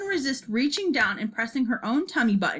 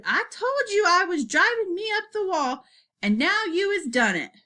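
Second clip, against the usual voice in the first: higher, lilting retort; cheeky comeback; 7 semitones higher than usual. A narrator's reading. Child on the ceiling answering back, lightly cheeky retort.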